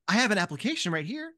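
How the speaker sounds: quick bureaucratic patter; fast, businesslike form-filling manager; brisk clerk. Acted. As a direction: rattle off the paperwork fast. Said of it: "they are like".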